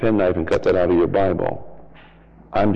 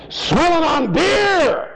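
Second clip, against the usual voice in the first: very high, very slow shout; drawn-out shout; much slower than usual. Acted. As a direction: stretch and yell it out. Mocking the pool hall image.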